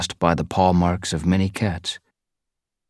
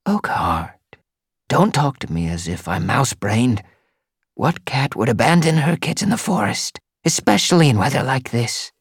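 higher, indignant she-cat; indignant queen cat; 6 semitones higher than usual. Acted. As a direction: scold him like a wise queen. Narrator voices the she-cat.

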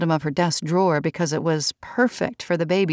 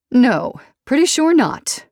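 high, louder, brisk dismissal; 7 semitones higher, 5 dB louder, slower than usual. A narrator's reading. Mother briskly denying grief for her own mother. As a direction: brush it off quickly. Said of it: "Georgina said briskly".

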